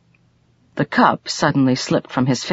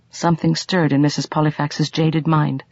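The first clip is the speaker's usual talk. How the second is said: usual speaking voice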